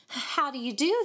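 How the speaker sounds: high mocking echo; mimicking. A narrator's reading. Boys mocking David's polite greeting, mimicking. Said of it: "they mimicked".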